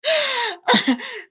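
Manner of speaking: rising high-pitched giggle; high laugh. Real, not acted. Host laughs at the call.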